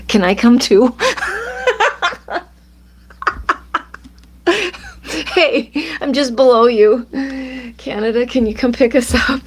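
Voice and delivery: high giggly excited voice; laughing excitedly. Real, not acted. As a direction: laugh and squeal with delight. Excited about viewer in Canada.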